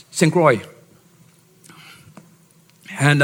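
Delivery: normal talking voice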